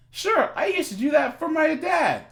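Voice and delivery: put-on high, keen girl voice; voiced young girl.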